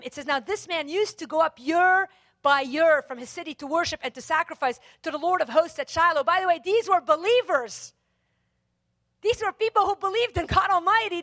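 ordinary talk

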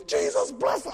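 high, loud prayer shout; forceful prayer. Retold or imitated. Youth worker about to hit the guy while praying, forceful shout. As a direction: cry it out like a zealous blessing.

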